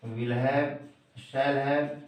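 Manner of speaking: ordinary delivery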